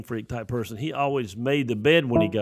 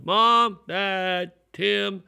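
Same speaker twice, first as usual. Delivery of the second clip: calling out for family, higher pitch; retold yelling; 6 semitones higher, much slower than usual. Boy searching the house for his family, retold calling out. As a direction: call through the house, not full force. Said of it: "I remember I started yelling".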